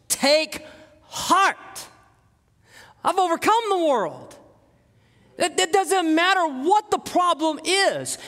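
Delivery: high-pitched, emphatic sermon delivery; stretched, ringing preacher's proclamation; impassioned preaching. Acted. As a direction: drive the promise home to a congregation. Preaches the promise with rising force.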